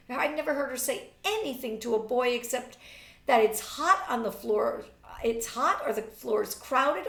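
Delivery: high, loud and scornful; mock her with disbelief; mocking young woman. A narrator's reading. Marjorie mocks Bernice.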